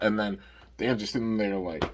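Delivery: usual speaking voice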